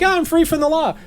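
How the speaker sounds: high-pitched, naively elated cheer; hammed-up carefree delight; mock celebration. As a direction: crow it like you got away with it. Acting out driver's mistaken celebration.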